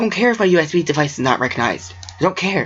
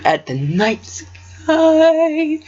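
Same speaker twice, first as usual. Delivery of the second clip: dreamy high drawl; 14 semitones higher, much slower than usual. Acted. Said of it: "reading in a strange voice".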